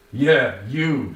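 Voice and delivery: mock accusation. A narrator's reading. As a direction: pin it on him.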